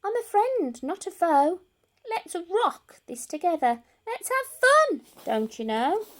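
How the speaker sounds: high, bright friendly voice; peeping mouse. Acted. Mouse reassuring the scared lion, high and friendly. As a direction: cheer the lion up. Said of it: "Mouse peeped".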